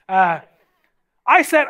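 ordinary delivery

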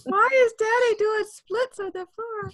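put-on very high child voice; puzzled little kid. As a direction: pipe up like a bewildered small child. Voicing a child watching Dad.

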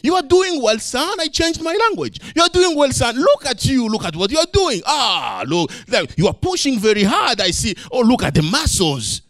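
father praising his son on the bike; encouraging dad. Retold or imitated. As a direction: cheer the boy on. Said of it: "And then I said".

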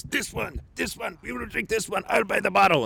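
high-pitched, insistent mock-Russian demand. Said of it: "stereotypically Russian guy".

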